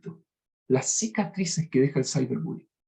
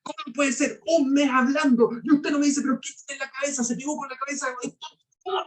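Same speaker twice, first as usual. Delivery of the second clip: much higher, exasperated scolding; angry teacher; 9 semitones higher than usual. Retold or imitated. Professor scolding Jaimito in class, angry and much higher. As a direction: scold the student in disbelief, pitch climbing high. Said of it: "Y yo estoy enojado y le digo".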